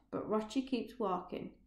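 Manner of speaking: ordinary delivery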